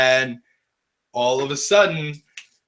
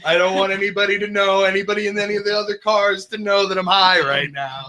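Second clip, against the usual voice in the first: giggling through the words; laughing while he talks; 4 semitones higher than usual. Real, not acted. Laughing while telling paranoid thought.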